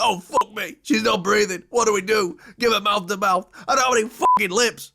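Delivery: a turtle losing it over a rescue; mock panic.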